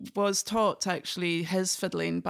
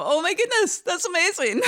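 high, loud, amazed "oh my goodness"; delighted exclamation; 12 semitones higher, 7 dB louder than usual. Retold or imitated. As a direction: burst out in delighted surprise. Retold delighted reaction meeting someone.

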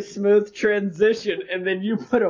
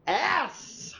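booming showman announcer; 9 semitones higher than usual. Pretend. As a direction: hype it like a ringmaster. Announcer intro for podcast.

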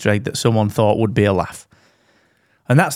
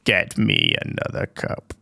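very deep, slow, quiet growling Emperor; Palpatine voice; 6 dB quieter, slower than usual. Retold or imitated. Emperor ordering his servant, very deep and slow. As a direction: rumble it low like a sinister Emperor. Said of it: "said Palpatine".

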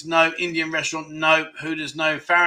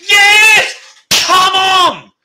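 very loud, high-pitched victory yell; real triumphant shout; 13 semitones higher, 8 dB louder, much slower than usual. Real, not acted. Quiz host celebrating a right answer at last, triumphant shout. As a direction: shout it out like you've just won. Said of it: "Sorry if I just shouted too loud".

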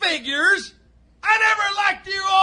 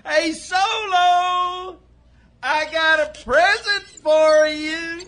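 taunting call. Acted. Clyde announces a surprise to Solo, taunting holler. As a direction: stretch the words out as a taunt.

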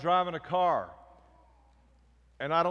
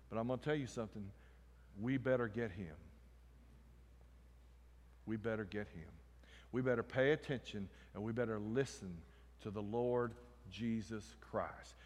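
low, soft, earnest warning; hushed admonition; 6 semitones lower, 10 dB quieter than usual. Real, not acted. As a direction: drop the voice and press the point gently. Preacher drops to grave admonition.

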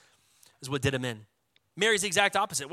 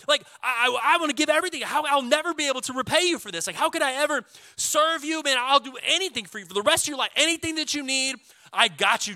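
high, loud, breathless thanks pouring out; overjoyed gratitude; 7 semitones higher, 4 dB louder than usual. Pretend. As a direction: gush with joy like a miracle just happened. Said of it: "I would be so stoked".

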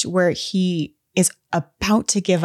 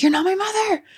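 much higher, sharp denial; indignant retort; faster than usual. Retold or imitated. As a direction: fire it back far higher. Priest rebuffs demon.

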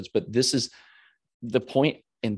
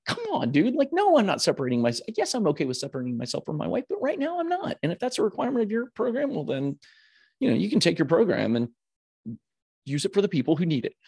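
much higher, half-mock heated rant; reliving losing it, pitched up and pushing; heated retort; 9 semitones higher than usual. Telling off the retreat interviewer, high heated rant. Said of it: "I lost it on this dude".

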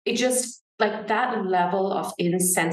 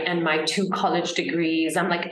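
ordinary talk

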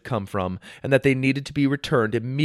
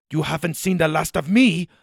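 villain threat; 6 semitones higher than usual. Acted.

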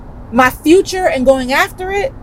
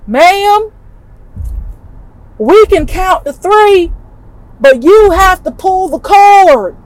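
higher, louder sweet Southern drawl of a ride operator; sweet Southern accent; 7 semitones higher, 3 dB louder, slower than usual. Retold or imitated. Ride operator calling up to the rider, in a sweet Southern accent. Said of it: "shouted back with this sweet little Southern accent".

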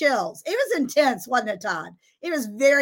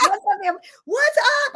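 loud, pitched up what's up; high excited greeting; 10 semitones higher, 4 dB louder than usual. Retold or imitated. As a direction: read it with glee. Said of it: "he says".

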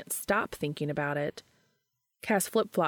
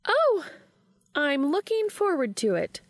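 higher voice, mock-formal reading of a text; Charlie, teasing friend; 10 semitones higher, 4 dB louder than usual. A narrator's reading. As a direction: tease him by reading it out. Charlie typing flirty replies.

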